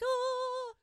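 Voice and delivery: high, breathy "Oh" of craving; crackhead seeing a rock. Retold or imitated. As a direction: gasp at the sight like an addict. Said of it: "like a crackhead when he sees a rock".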